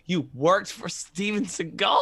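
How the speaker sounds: shocked disbelief; amazed question; surprised. Real, not acted. Surprised at Steven Seagal news.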